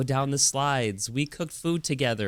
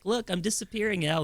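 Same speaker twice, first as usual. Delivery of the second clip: put-on high playful call to the child; playful dad; 10 semitones higher than usual. Dad hiding in ball pit calling daughter, playful. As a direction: tease the kid like a game. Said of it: "I'll be like".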